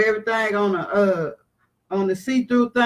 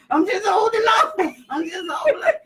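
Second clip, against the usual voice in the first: high, crying wail of holding on; a frightened passenger's high cry; pitched way up, crying out in fear; scared whimper; 9 semitones higher than usual. Retold or imitated. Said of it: "I'm back here ... going".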